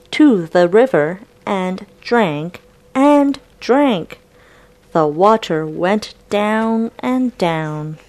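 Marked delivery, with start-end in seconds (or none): none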